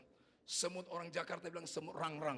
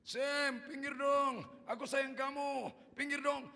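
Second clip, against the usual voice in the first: high, slow, loud singsong plea; drawn-out high pleading; child coaxing ants; 7 semitones higher, 6 dB louder, much slower than usual. Retold or imitated.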